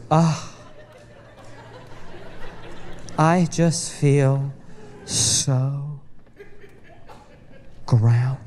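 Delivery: slow, low, blissed-out sigh of calm; dreamy spiritual wife. Retold or imitated. Wife leaving the crystal shop, blissfully serene. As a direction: drift out the words like after a spa session. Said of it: "she just goes".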